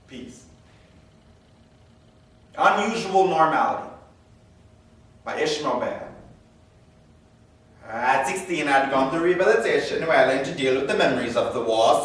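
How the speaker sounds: ordinary delivery, nothing marked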